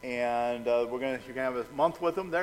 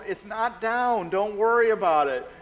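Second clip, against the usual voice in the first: higher, stretched-out calming of a panicker; raised, insistent don't-worry voice; retold reassurance; 7 semitones higher, 4 dB louder than usual. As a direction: imitate talking someone down, emphatically.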